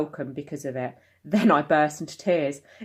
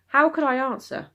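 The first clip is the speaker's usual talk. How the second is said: ordinary delivery, nothing marked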